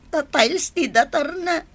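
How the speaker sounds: normal talking voice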